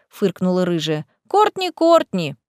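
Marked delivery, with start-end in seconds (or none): 1.3-2.3 teasing sing-song. A narrator's reading. Cat teases Lisa.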